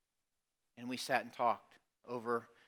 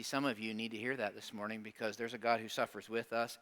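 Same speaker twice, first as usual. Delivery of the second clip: ordinary delivery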